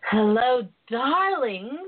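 ordinary talk

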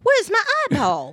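high, loud mock Tracy voice; Tracy Morgan impression.

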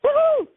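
high, loud woo-hoo cheer; jubilant whoop. Retold or imitated. Woman celebrating victory over the demon, jubilant loud whoop. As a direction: whoop it out, pitch leaping up. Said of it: "I felt jubilant".

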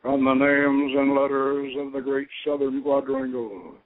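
grave ceremonial calling of the watchtower; ritual invocation. Real, not acted. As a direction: proclaim it to the angels of the south.

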